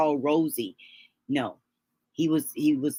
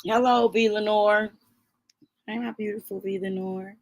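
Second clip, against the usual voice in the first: higher, sweet hello to a viewer; sweet greeting; 4 semitones higher than usual. Real, not acted. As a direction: sing out a fond welcome.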